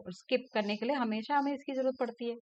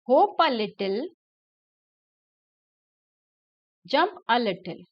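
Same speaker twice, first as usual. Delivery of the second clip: poem recitation; 4 dB louder, much slower than usual. Acted. Reads poem with class.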